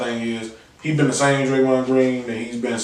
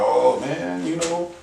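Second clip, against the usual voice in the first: softly voiced, wheedling complaint; mock pleading partner; 3 semitones higher, 6 dB quieter than usual. Partner questioning bad behavior, mock soft pleading. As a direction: act out a worried partner, hushed. Acts out a partner once trouble starts.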